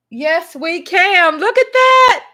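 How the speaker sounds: loud, high rally cry; excited cheering. Real, not acted. Supporter cheers over campaign shoe, excited shout. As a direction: belt it out like a proud supporter. Cheering at what hubby shows.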